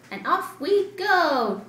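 usual speaking voice

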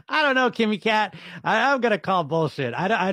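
incredulous laugh through the words. Real, not acted. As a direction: laugh it off, can't buy it. Disbelief at a weight-loss claim.

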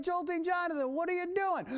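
high, loud squeal of alarm; mock panicked crew. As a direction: play the studio crew shrieking at him. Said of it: "They're like".

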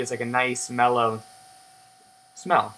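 ordinary delivery

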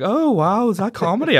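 put-on high, wide-eyed girlfriend voice. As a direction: imitate her amazed question. Girlfriend quoted at gig.

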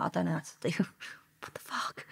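stunned, laughing whisper; shocked whisper. Retold or imitated. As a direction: gasp it out under your breath in disbelief. Shocked aside after shutting laptop.

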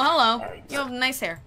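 normal talking voice

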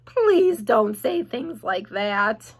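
slow, dismayed groan in a piglet voice. A narrator's reading. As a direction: groan it out slowly. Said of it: "groaned Wilbur".

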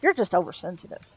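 ordinary delivery, nothing marked

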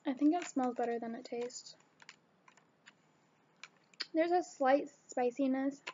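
talking with food in mouth; chewing while talking. Real, not acted. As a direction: mouth partly full. Seen 0: eats nuts from bag, puts some in mouth, chews while talking.

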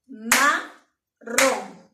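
Marrón split as ma-rrón, loud, one clap per syllable; clapped syllables. Shown as a demonstration. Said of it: "¿Cuántas palmadas he dado?". (Marrón silabeado en voz alta con palmadas)

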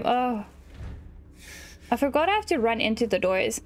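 usual speaking voice

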